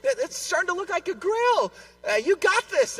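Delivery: lightly voiced wife cheering him on; encouraging wife. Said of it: "She's trying to encourage me".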